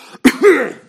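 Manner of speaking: a hacking, hammed-up cough right at the door; loud mock cough. Sick greeter coughing loudly on arriving guests.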